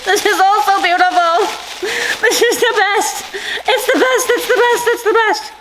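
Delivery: high, loud, laughing delight; laughing with joy. Real, not acted. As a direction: gush with happy laughter. Overjoyed by the gifts.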